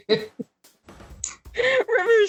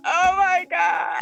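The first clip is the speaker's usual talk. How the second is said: shocked oh my God; 7 semitones higher, slower than usual. Acted.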